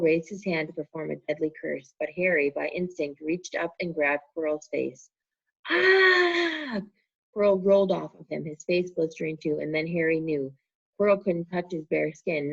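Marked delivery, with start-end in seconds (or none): 5.7-6.8 high, loud pained scream; a burned man's shriek; pained cry. A narrator's reading. Quirrell burned by Harry's touch, a high loud pained scream.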